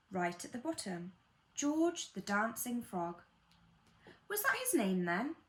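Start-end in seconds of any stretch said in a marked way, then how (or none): 4.3-5.3 put-on higher-pitched curious child; lightly voiced child butting in; child's voice. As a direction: ask it like a puzzled child. Child interrupts the story with question.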